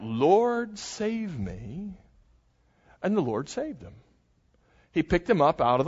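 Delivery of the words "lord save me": mild cry for help. Acted. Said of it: "he cried out".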